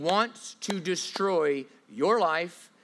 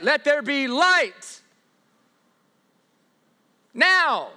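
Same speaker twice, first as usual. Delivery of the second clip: "Let there be light" pushed higher and louder, slowly, as if struggling; mock straining command; 6 semitones higher, 5 dB louder, much slower than usual. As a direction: ham up a God who has to force it. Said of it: "He doesn't need to be like".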